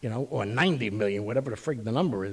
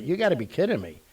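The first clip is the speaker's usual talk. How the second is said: normal talking voice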